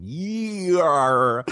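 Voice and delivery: mocking, endlessly drawn-out Year; hammed-up southern drawl. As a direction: swoop up and stretch Year absurdly long. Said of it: "there's, like, four or maybe fourteen".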